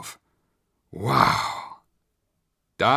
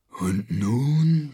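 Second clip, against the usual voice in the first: commanding monster; 3 semitones higher than usual. Acted. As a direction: order it like a beast. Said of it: "befahl das Ungeheuer".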